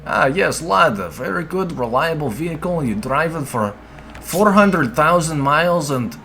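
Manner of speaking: put-on high, hammy Russian accent; jokey Russian accent, praising the car. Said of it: "ascribing Russian accent voice to it".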